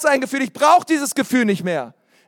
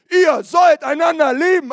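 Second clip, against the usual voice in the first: raised, ringing proclamation; emphatic preached command; proclaimed command; 5 semitones higher than usual. Acted. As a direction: call it out like an order from the pulpit.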